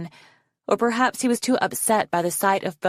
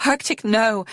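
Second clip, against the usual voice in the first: raised cry of alarm; horror; 5 semitones higher, 4 dB louder than usual. A narrator's reading. Foeslayer grabbing Arctic's arm, horrified. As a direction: cry out to stop him, pitched up. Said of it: "grabbed his arm in horror".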